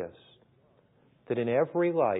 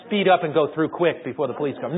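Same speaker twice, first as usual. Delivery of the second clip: mock wrong answer; 2 semitones higher, 9 dB louder than usual.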